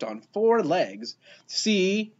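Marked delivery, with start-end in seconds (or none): 1.5-2.1 high, vindicated exclamation; exclaiming. A narrator's reading. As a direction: point out proof triumphantly. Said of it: "exclaimed Marilyn".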